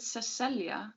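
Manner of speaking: Cecilia as seh-SELL-ya; old spelling's sound. A narrator's reading. Narrator recalls the old spelling, Cecilia as seh-SELL-ya. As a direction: say Cecilia in three flowing syllables, seh-SELL-ya. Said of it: "When she spelled it".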